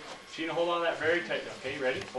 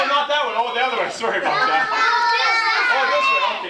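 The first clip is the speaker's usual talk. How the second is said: put-on kids party magician; 12 semitones higher, 11 dB louder than usual. Playing dumb for kids.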